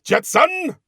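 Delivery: high mock boss holler; hammed-up frustrated scream; villain's shout. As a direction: yell the name like a thwarted villain. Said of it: "Like that".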